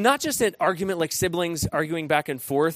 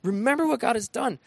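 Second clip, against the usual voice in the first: urgent plea; 9 semitones higher than usual. Retold or imitated. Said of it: "godly leader who's saying".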